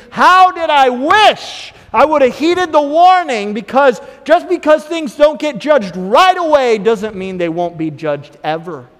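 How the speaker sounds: loud, high preaching at full force; fiery preacher's voice. Acted. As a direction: preach it hard to the congregation.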